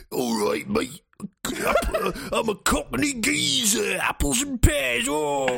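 hammed-up Cockney, rising high and stretched out; Cockney geezer. Recruited orc greeting his new master, broad mock-Cockney. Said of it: "we got the Cockney geezer orc".

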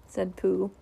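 usual speaking voice

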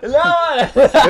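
loud, high, drawn-out protest through laughter; laughing protest. Real, not acted. Husband teased about his attractive wife, loud laughing protest. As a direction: object loudly while cracking up.

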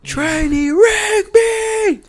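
stretched, high drill sergeant shout; drill sergeant yell. Retold or imitated. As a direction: yell his name across the room, stretched slow. Drill instructor calling out a trainee.

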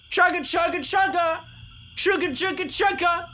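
chugging toy train voice. Acted. Train conductor mimics a train.

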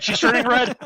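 loud high teasing cry. Real, not acted.

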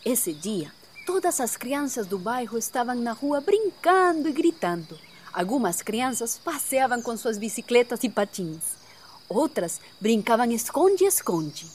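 put-on children's story narration. A narrator's reading.

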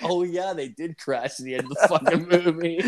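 laughing. Retold or imitated. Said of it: "I'm like".

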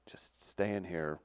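slow, soft half-whisper; hushed confiding. Retold or imitated. Coach hiding player in his office, whispering. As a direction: keep it secret and hushed.

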